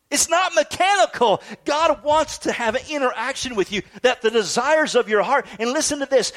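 high, insistent preacher's voice; impassioned preaching. Acted. Preacher telling congregation God cares about their desires, impassioned. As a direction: drive it home from the pulpit.

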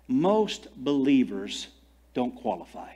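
slow, dropping to a hush on qualify; slow emphasis. Acted. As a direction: lay it down slowly, then whisper. Slow stressed line.

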